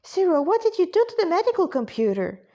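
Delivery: put-on high, quick little-girl voice; small character piping up urgently; childlike questioning. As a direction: pester with a fast, high-pitched question. Child character asks while hurrying after.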